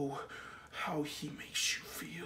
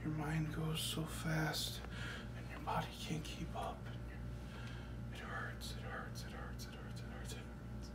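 soft whisper, soothing himself; whispered comfort; 9 dB quieter than usual. Acted. Man whispers to himself to calm his fear, soft whisper. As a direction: hush yourself through the panic.

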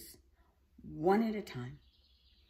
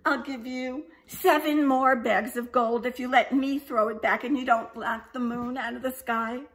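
put-on higher, louder eager young dragon; baby dragon voice; 6 semitones higher, 9 dB louder than usual. A narrator's reading. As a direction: bargain eagerly as the little dragon. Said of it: "said the baby dragon".

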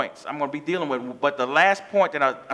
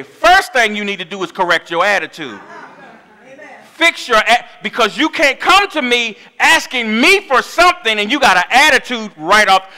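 a hint of a scolding mother; stern mama; 5 semitones higher, 7 dB louder than usual. A preacher imitating his mama scolding a child, louder. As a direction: lay down the law like mama. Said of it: "the way my mama did it".

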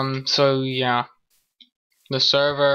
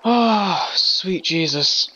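relieved exclamation; 5 semitones higher, 3 dB louder, much slower than usual. Real, not acted. Relief at finding home.

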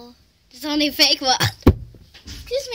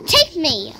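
mock flirt; 10 dB louder than usual.

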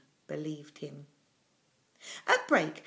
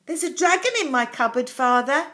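put-on high, carefully polite child; 6 semitones higher, 9 dB louder than usual. A narrator's reading. Boy tells his father about the dragon, politely. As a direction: address father respectfully, higher and louder. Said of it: "he said politely".

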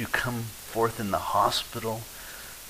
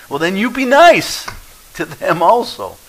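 much higher and louder, slowing on the end; preaching shout; 9 semitones higher, 11 dB louder than usual. Real, not acted. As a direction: hammer the answer home from the pulpit. Preacher's forceful answer.

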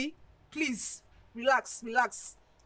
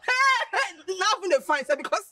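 excited; 7 semitones higher, 6 dB louder than usual. Acted. Friend excited about money.

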